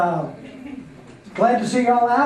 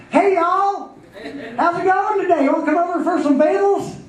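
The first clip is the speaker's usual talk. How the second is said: much higher and louder, hammed-up Southern welcome with laughter; mom's new Southern twang; 11 semitones higher, 3 dB louder than usual. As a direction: overdo mom's just-learned Southern cheer. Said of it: "here's my mom".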